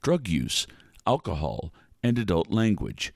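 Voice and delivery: low disclaimer read; deadpan announcer; announcer warning. Acted. Second warning.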